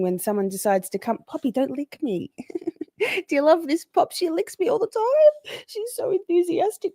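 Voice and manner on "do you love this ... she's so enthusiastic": dog voice. Real, not acted. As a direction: coo at the pet in a high voice. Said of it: "That's my dog voice".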